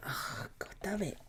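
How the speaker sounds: slow, breathy whispered curse. Real, not acted. Fan whispering his despair as the game nears. Bad news before the game.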